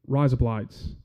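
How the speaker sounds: "Rise up lights" in an Aussie accent, low, sounding like razor blades. Shown as a demonstration. Demonstrating razor blades to the crowd, low Aussie accent. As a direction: run "Rise up lights" together as an Australian saying "razor blades". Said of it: "Listen for razor blades in an Aussie accent".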